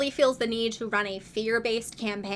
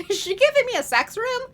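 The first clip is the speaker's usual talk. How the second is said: much higher, louder disbelieving squeal; high, loud mock-shocked question; shocked squeak; 13 semitones higher, 5 dB louder than usual. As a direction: squeal it in scandalised surprise. Mia realizing the room's purpose.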